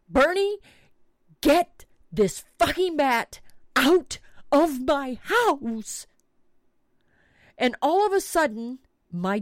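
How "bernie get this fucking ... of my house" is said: imitating her own scream, high and loud; mock yell for help, pitched up; retold scream. Mother calling her son to remove a bat, mock screaming. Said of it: "I start screaming".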